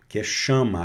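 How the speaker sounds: usual speaking voice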